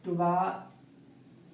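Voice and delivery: ट्वाइस stretched very slowly into parts, "tu-waa ... is"; teacher breaking ट्वाइस into "tvaa" and "is"; drawn-out pronunciation. Shown as a demonstration. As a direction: sound out ट्वाइस slowly for learners, holding the long aa. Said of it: "बोलते हैं".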